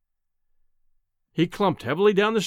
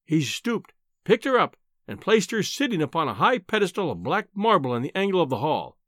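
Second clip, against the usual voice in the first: ordinary delivery, nothing marked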